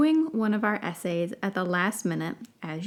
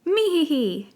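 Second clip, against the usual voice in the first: creature giggle; 7 semitones higher, 3 dB louder than usual. Acted. Puffskin trying to copy laugh.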